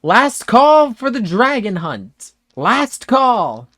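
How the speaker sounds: high, drawn-out town-crier call; loud, high-pitched final announcement; Moogle shout. Acted. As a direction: call it out loudly to a whole crowd. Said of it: "you hear the Moogles shout".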